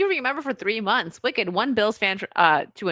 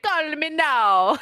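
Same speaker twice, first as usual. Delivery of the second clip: slow hammed-up Jamaican catchphrase; Miss Cleo; 7 semitones higher, 3 dB louder, much slower than usual. TV psychic selling her hotline, fake Jamaican accent. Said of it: "like, Jamaican accent".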